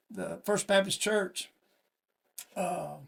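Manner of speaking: usual speaking voice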